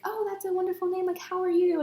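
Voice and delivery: high, gracious greeting; genuine-sounding, high-pitched politeness; polite. Retold or imitated. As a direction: imitate an author politely greeting a fan. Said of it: "it was so polite".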